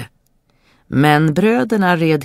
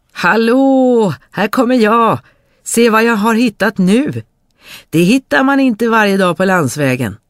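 high, louder cheerful hail; calling out; 8 semitones higher, 5 dB louder than usual. Acted. Dummerjöns hailing his brothers again, gleeful shout. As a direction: yell gleefully down the road.

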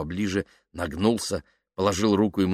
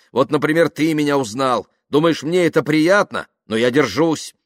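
measured solemn confession building louder and higher; fervent penitent Kaftanov; 6 semitones higher, 5 dB louder than usual. Acted. Kaftanov explaining his penance as a novice, fervent and wounded. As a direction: confess with growing fervour.

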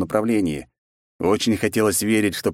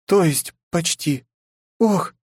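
high, slow worried princess; alarmed princess; 10 semitones higher, slower than usual. Acted. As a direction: gasp in sudden pain.

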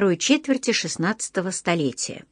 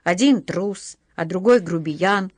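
ordinary delivery, nothing marked

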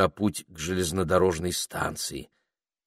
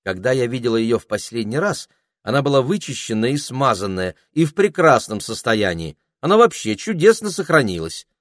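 higher, louder, eager defence; заступаясь; 5 semitones higher, 6 dB louder than usual. A narrator's reading. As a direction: stand up for her, pitch climbing. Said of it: "вступилась Пенелопа". (горячо заступаясь, повышая голос)

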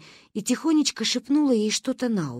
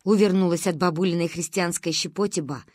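plain reading voice

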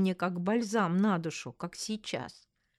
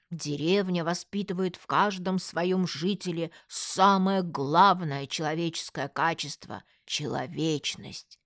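ordinary delivery

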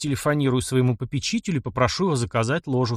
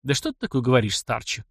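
ordinary delivery

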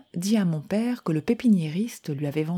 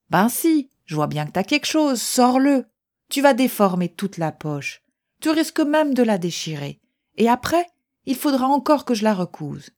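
scolding mother; 6 semitones higher, 5 dB louder than usual. Acted. Mother scolds boy over pocket.

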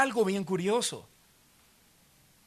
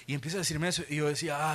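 ordinary delivery

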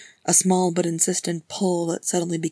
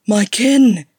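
loud, higher astonished cry; 4 semitones higher, 12 dB louder than usual. Acted. Gimli learns the voices are kin, astonished. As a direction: burst out in shock. Gimli nearly falls off the rock.

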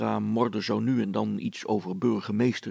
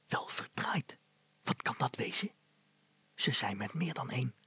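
hushed worried whisper; whispered, much softer; 3 semitones higher, 6 dB quieter than usual. Acted. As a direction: whisper from behind the wall. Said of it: "fluisterde Brilstra".

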